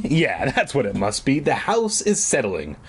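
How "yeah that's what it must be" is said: plain reading voice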